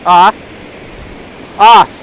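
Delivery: sharp, loud "off"; firm dog command. Real, not acted. Owner ordering the dog off, loud and firm.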